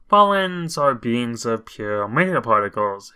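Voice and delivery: usual speaking voice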